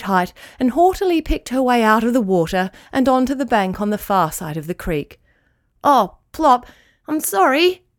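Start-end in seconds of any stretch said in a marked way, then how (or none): 5.8-7.8 higher, louder sorry cry; calling out apology. Acted. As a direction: call out an anxious apology. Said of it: "called out Shaggles".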